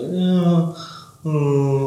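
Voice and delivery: drawn-out, musing "oh"; mock philosophical musing. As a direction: ponder grandly, half sung. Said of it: "sort of quite philosophical about things".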